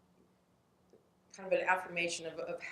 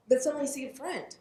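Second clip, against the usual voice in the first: usual speaking voice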